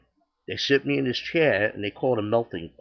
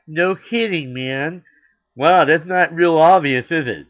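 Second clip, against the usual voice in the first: loud, mocking no-kidding jab; sarcastic retort; 5 semitones higher, 9 dB louder than usual. To a stranger offering prayer, loud sarcastic jab. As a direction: throw the sarcasm back at him loudly.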